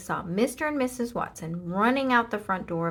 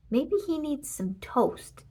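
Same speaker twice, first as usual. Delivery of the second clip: kindly fireman; 6 semitones higher, slower than usual. A narrator's reading. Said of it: "said Ned".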